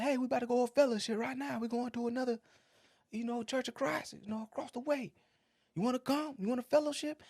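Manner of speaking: Southern women calling out friendly from a truck; Southern accent. Retold or imitated. Southern women in a car greeting Cedric's son, high Southern accent. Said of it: "every person in that car had an accent too, from the South".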